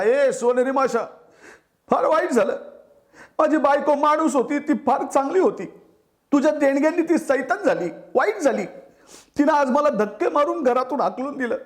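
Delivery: high, wailing lament; sorrowful plea. Acted. Grieving fisherman begs the golden fish to take gifts back. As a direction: pour out grief to the fish, pleading.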